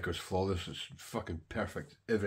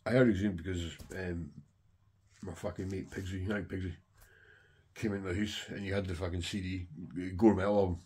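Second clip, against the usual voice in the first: ordinary delivery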